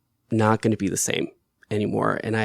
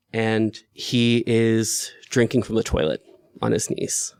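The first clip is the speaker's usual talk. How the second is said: normal talking voice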